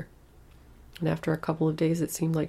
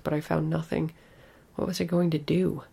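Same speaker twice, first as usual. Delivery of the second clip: ordinary delivery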